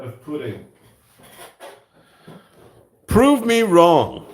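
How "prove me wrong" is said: much higher, louder and slow, a hammed-up challenge; bellowing a theatrical dare; mock defiant. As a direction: throw down the gauntlet, slow and grand. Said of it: "That's what I say".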